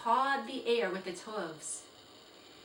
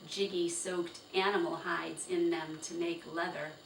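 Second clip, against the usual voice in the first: ordinary delivery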